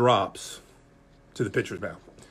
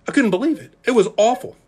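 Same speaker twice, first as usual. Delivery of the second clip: higher and louder, incredulous; mock disbelief; 9 semitones higher, 4 dB louder than usual. Real, not acted. Preacher to congregation, hammed-up disbelief at his speed. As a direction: sell the shock of the bad number.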